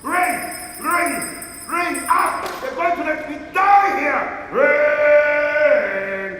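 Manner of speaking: loud, high bell-calls mixed with insults; furious shouting. Acted. Abandoned invalid summoning deaf servants, furious shouting. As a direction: shout "ring" like a frantic bell, enraged. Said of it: "I'm furious!".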